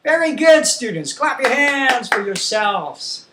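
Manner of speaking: high, loud classroom cheer; big, encouraging teacher voice; teacher's praise. Pretend. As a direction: praise a class of young pupils. Praising imagined class after song.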